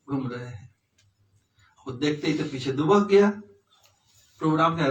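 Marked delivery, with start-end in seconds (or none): none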